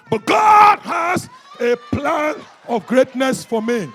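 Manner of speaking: loud, high, stretched-out declaration; slow, emphatic preacher's shout; triumphant proclamation. Real, not acted. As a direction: burst out against all the doubts. Defiant climax after listing troubles.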